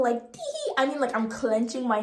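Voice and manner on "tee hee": tiny squeaky tee hee far higher; a dainty fake titter; mocking a polite little laugh; mock giggle. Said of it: "I don't mean just a little".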